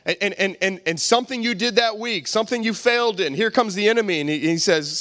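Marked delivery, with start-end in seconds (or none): none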